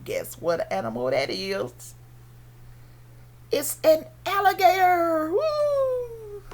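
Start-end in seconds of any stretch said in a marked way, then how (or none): 3.5-6.4 excited reveal. Pretend. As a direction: celebrate the answer for little kids.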